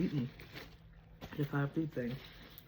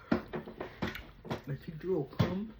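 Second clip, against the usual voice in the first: solemn proverb; 2 semitones higher, much slower than usual. Acted. Quoting proverb, slowed.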